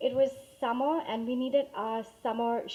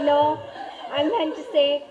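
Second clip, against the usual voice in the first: laughing while correcting; 2 semitones higher, 11 dB louder than usual. Real, not acted. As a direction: laugh it out, then explain. Laughing at kids' misunderstanding.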